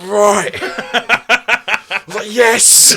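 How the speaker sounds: loud, high, through laughter; fired-up mock yell. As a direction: relive the rage while laughing.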